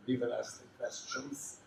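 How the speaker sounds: lightly put-on German interrogator; deliberately poor German accent; mock German accent. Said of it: "I'm doing that German".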